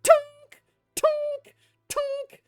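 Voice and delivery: high, ringing imitated metallic clangs; loud forge clank. Game master imitates the forge heard from inside, loud clanks. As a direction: imitate a hammer ringing out, evenly spaced. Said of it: "you hear, like, a loud".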